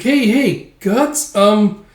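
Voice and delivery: high, squeaky nudging for attention; insistent but hesitant sidekick; pestering elf. Acted. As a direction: call him, then falter. Puck tries getting attention.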